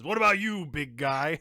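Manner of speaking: lightly voiced dwarf throwing down a challenge; dwarf challenging. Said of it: "he turns to Girgroth then and says".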